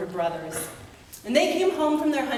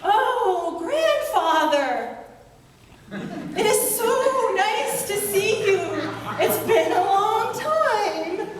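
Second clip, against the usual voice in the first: much higher, louder, sing-song nervous greeting with a laugh; nervous brothers; 10 semitones higher, 4 dB louder, slower than usual. Acted. Frightened brothers greeting the giant, high and nervously cheerful. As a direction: greet him brightly while trembling inside.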